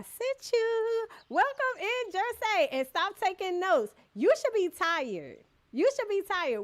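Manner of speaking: high, loud teasing welcome; loud playful greeting. Real, not acted. Streamer welcoming a friend into chat, loud and teasing. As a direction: call out to a friend with a mock scold.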